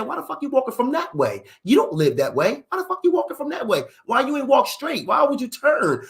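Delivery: high, rapid-fire father's questions; imitated angry interrogation. Imitating dad grilling son on his route, high and exasperated. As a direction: mimic dad piling on the questions.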